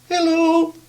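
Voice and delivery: a tiny high squeak of a voice. Acted. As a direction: voice little Mister Small, very high. Tiny Mister Small's voice.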